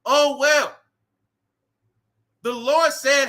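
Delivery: normal talking voice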